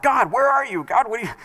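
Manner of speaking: mock frustrated plea. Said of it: "It was not".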